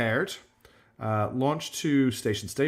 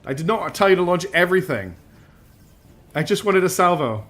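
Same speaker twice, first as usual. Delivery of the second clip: higher, louder indignant protest; aggrieved, raised-voice complaint; exasperated scolding; 4 semitones higher, 4 dB louder than usual. Real, not acted. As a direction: scold the crew who disobeyed you. Scolding game crew for firing everything.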